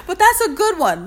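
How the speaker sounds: laughing through the words. Real, not acted. Proud of her line, still laughing. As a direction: still giggling as you say it.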